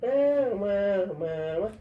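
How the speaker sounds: put-on high playful meow for the child; mother showing a meow. Shown as a demonstration. Mother demonstrates a cat's meow to her child. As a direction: mom meows so the little one copies. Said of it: "बिल्ली करती, कैसे करती बिल्ली?".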